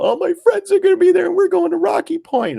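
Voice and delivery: very high, loud mock preteen panic; freaking-out daughter. Daughter torn between party and trip, mock freak-out. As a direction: squeal it like an upset twelve-year-old girl. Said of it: "she's, like, freaking out".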